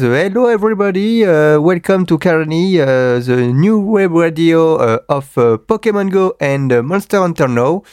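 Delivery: hammed-up English-accented radio intro; a French host playing an English DJ; playful mock English accent. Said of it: "je pourrais vous faire une émission à l'anglaise".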